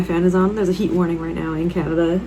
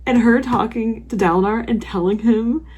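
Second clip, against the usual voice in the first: ordinary delivery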